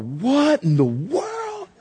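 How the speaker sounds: stunned onlooker can't believe his eyes; baffled. Acted. A shopper watches a stranger get punched, slow high disbelief. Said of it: "you're all".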